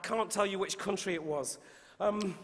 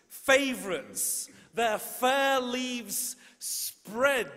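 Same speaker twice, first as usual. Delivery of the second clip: slow, building recitation, louder on the battle lines; poem reading; 6 dB louder, much slower than usual. Shown as a demonstration. Poet reciting Shakespeare's sonnet to the audience, building. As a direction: savour each phrase, then end very slowly. Reads Sonnet twenty-five aloud.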